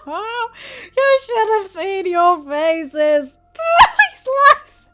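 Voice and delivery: very high, loud, slow words through laughter; laughing. A narrator's reading. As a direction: crow it out while cracking up. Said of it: "laughing his head off".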